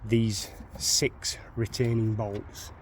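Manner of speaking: usual speaking voice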